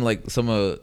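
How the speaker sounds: ordinary talk, nothing marked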